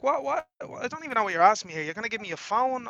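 high-pitched retold confusion; acting out total confusion; baffled protest. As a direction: protest in bewilderment, voice lifted.

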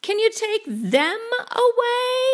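put-on high, exasperated kid voice; high-pitched child's protest; dismayed boy.

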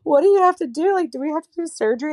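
high, fast, loud panicked questions; retold panic. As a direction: rush out the questions like a frightened mom. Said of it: "And I'm like".

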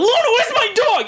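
high, stretched-out holler; mock frantic yell. Man running down the street after Luna, frantic shout. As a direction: bellow for the dog at a high pitch. Imitates owner calling lost dog.

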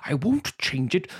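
hammed-up actor in a put-on accent; Daniel Day-Lewis. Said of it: "Daniel Day-Lewis pulling center stage with his".

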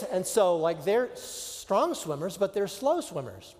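ordinary talk, nothing marked

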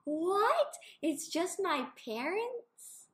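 high, loud disbelieving questions; shocked child. Retold or imitated. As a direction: gasp it out like a betrayed kid. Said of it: "I was like".

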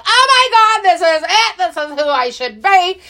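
high, elated rush; light up with joy; trying on the jumper, high and thrilled; excited. Retold or imitated. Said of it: "And I was just like".

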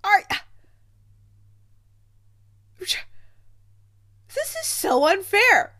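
high, loud, slowing on the last words; stunned disbelief. Retold or imitated. Reviewer reliving first sight of Doctor Greg, loud excited disbelief. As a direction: replay the gasp of seeing him on screen. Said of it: "I about fell out of my chair. I was like".

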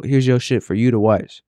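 usual speaking voice

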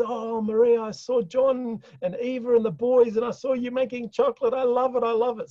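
hammed-up excited Greek uncle; imitating uncle. Uncle in Greece voiced on phone.

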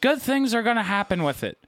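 high-pitched, hammed-up old-timer; old person voice. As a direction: play an old person giving advice. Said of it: "with an old person voice".

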